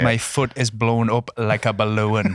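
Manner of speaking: slow, overpronounced Irish fighter impression; Conor McGregor. Podcaster mimicking fighter's injury talk, overpronounced impression. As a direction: stretch each word in imitation Irish. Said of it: "you're doing the Conor McGregor".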